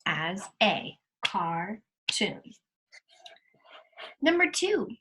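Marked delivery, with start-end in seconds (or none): 1.2-2.4 "cartoon" said slowly, syllable by syllable as "car-toon"; split into syllables. Shown as a demonstration. Teacher to class, showing the split, "cartoon" said slowly in beats. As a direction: break "cartoon" into its two beats. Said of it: "that you would split it".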